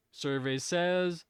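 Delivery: slow, high host-style buildup; game show host reveal. Pretend. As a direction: stretch it out like announcing the board. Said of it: "sitting here in the Family Feud".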